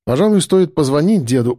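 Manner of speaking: usual speaking voice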